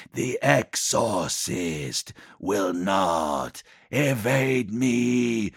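slow demon voice from afar; echoing from a great distance; distant demon voice. Acted. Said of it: "The voice sounded as if from a great distance now".

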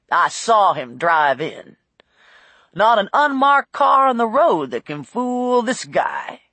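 chatty driver showing off; boastful old cab driver. Acted. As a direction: boast like a know-it-all driver.

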